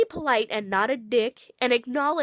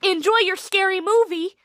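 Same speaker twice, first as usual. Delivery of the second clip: mock greeting; 7 semitones higher, 5 dB louder than usual. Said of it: "I'd say".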